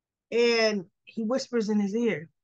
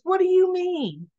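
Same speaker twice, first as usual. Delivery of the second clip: incredulous; 8 semitones higher, 4 dB louder than usual. Retold or imitated. Judge incredulous in retelling.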